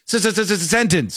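repeated S before "sentence"; mock stutter. Host imitates the bartender unable to end a sentence, mock stuttering. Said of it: "you can't get the end of your".

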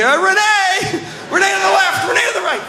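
very high, mock-frantic shouting; calling the dog. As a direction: holler commands to a sled dog. Commanding his head dog.

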